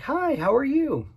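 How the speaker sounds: teenage cashier greeting a customer, mock sweet; imitating a cashier's greeting. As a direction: put on a chirpy checkout girl voice. Mimicking cashier girl's greeting.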